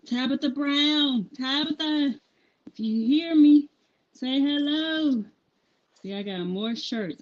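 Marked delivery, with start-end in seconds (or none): 0.1-5.2 higher, louder, calling out to her across the distance; excited calling out. Real, not acted. Calling out to Tabitha Brown, excited and loud. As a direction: shout her name hoping she hears.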